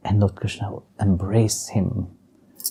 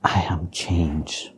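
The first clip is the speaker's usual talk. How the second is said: slow, hushed whisper; whispered quote; 3 semitones lower, 8 dB quieter, much slower than usual. Retold or imitated. Visitor retelling what his family told him, whispered. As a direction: breathe it out slowly like a secret. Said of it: "everybody was telling me".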